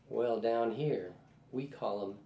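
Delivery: slow, lightly drawled Southern Nashville voice; a hint of a Tennessee drawl, drawn out. Woman on her porch answering the interviewer, in a slow Southern drawl. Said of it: "in her beautiful Southern Nashville drawl".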